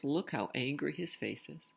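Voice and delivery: ordinary delivery, nothing marked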